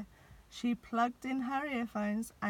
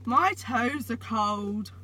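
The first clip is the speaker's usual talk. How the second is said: slow, louder complaining yell; 2 semitones higher, 8 dB louder, slower than usual. A narrator's reading. Santa complaining from the chimney, a slow loud yell. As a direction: yell it out in cold misery. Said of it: "yelled Santa".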